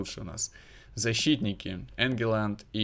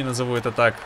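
usual speaking voice

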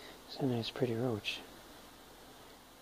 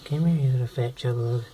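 high, loud doting call to a pet; cooing pet talk; 5 semitones higher, 10 dB louder than usual. Acted. Owner cooing at her pet roach Mia, doting pet talk. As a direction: coax the bug over like a baby.

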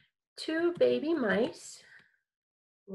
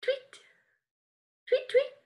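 very high chirped tweets; very high bird tweets; 14 semitones higher than usual. Shown as a demonstration. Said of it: "How about this one?".

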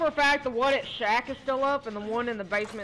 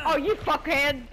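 angry outburst; 5 semitones higher, 4 dB louder than usual. Real, not acted. Chased by killer in game.

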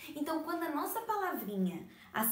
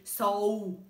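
"sol" stretched long and loud with a wavering pitch; attempted split, drawn out; 3 semitones lower, 6 dB louder than usual. Shown as a demonstration. Teacher showing sol cannot be divided, drawn-out word. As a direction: drag out "sol" as if trying to divide it. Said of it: "não tem como dividir".

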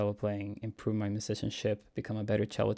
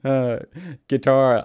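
mock exclaiming; 4 semitones higher, 11 dB louder, slower than usual. Real, not acted.

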